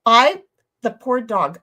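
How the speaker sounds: ordinary talk, nothing marked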